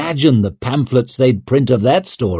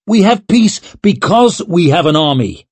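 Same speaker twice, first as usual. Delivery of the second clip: indignant; 7 semitones higher, 5 dB louder than usual. A narrator's reading. Leo objecting hotly.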